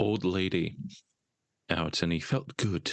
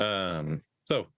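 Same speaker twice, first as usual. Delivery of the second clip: normal talking voice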